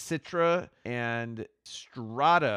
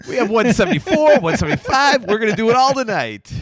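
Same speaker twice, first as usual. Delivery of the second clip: excited; 9 semitones higher, 10 dB louder, faster than usual. Acted. Joking excited exaggeration.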